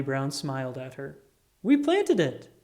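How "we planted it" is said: bright, high proud announcement. A narrator's reading. Charlie Brown tells Lucy they planted it, high and proud. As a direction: tell her with pride.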